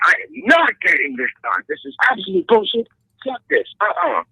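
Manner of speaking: high indignant outburst; huffy and rising; wife storming off, mock fury; mock angry refusal. Angry wife quote in story.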